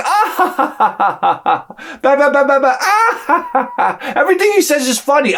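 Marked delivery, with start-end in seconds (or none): 0.0-1.7 high fake cackle, imitating her; mock laughing wife. Husband mimicking his wife's giggle at the carpenter's jokes. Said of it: "and she's laughing". 2.8-4.1 high fake cackle, imitating her; mock laughing wife. Husband mimicking his wife's giggle at the carpenter's jokes. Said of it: "and she's laughing".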